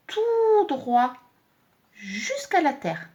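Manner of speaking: "tout" high, loud and stretched, then slowly down to "terre"; descente mimée. Shown as a demonstration. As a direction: draw out "tout droit", then slide down through "jusqu'à la terre". Voice mimics the descending line. (« tout » aigu et étiré, puis descente lente)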